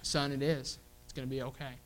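soft, gentle comfort to a son; dad quietly reassuring his worried son; reassuring father. Retold or imitated. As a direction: settle his fear quietly. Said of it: "You know what I can say".

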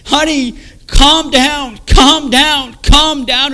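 high, very loud pleading yell; screaming wife. Retold or imitated. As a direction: imitate a wife desperately shouting over a frenzy. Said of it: "my wife screaming".

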